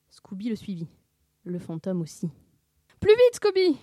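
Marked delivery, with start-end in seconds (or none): 3.0-3.8 very high, loud urging; panicked yell. A narrator's reading. Sammy fleeing the ghost urges Scooby, yelling. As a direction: yell to hurry him along. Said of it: "hurla Sammy".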